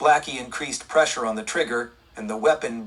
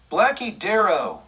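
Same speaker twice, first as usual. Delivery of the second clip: startled gasp of recognition, higher; 6 semitones higher, 3 dB louder than usual. A narrator's reading. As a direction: gasp out the name in shock. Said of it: "the kid gasped".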